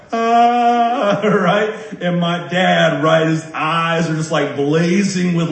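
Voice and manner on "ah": high startled ah; a quick high gasp of dismay; mock gasp. Boy realizes he pushed mom, a high mock gasp. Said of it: "And I'm like".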